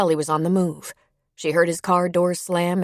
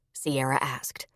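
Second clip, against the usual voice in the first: ordinary delivery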